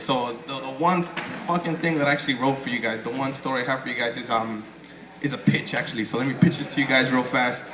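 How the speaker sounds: ordinary talk, nothing marked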